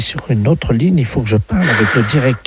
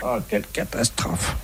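breathy whispered lament, almost to himself; whispered despair; 4 semitones lower, 10 dB quieter than usual. Acted. Fake inspector to principal, whispering in mock despair. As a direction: mutter the disaster under your breath. Caller panics, principal knows all.

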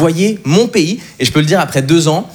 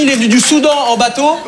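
ordinary talk, nothing marked

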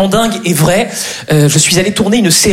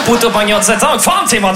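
hammed-up gibberish Chinese, ranting; angry fake Chinese; 3 semitones higher than usual. Comedian fakes angry Chinese on film set, mock-fierce. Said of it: "Le chinois énervé".

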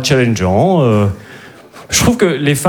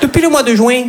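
put-on much higher rom-com line in a Midi accent; southern French accent; 10 semitones higher than usual. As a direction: play a New York romance with the accent du Midi. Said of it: "comédie romantique à New York avec l'accent du Midi". (accent du Midi en comédie romantique, voix plus aiguë)

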